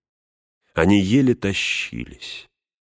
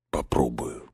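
low, soft, drowsy mumble; dull, half-asleep; 5 semitones lower, 7 dB quieter than usual. A narrator's reading. Frodo agrees to try climbing, dull and drowsy. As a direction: let it sink, as if nearly asleep. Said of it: "сказал Фродо тусклым голосом, точно в полусне".

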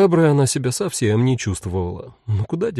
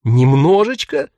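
incredulous; 3 semitones higher, 5 dB louder, slower than usual. A narrator's reading. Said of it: "хором переспросили".

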